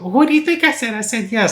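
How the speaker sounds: high, sassy imitation of her younger self; mock exasperated girl. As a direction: roll the eyes and squeak it out. Imitating wife answering her dad.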